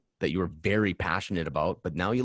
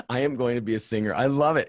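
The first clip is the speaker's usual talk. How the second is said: amused excitement; 5 semitones higher, 6 dB louder than usual. Real, not acted. Teasing laugh about singer dream.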